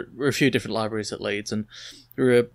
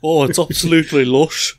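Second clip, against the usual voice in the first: louder, higher put-on Brummie; Brummie accent; 4 semitones higher, 6 dB louder than usual. Shown as a demonstration. Joining friend's accent joke, hammed-up Brummie accent. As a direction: play up a Brummie accent on "lush". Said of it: "It wouldn't work in the Brummie accent".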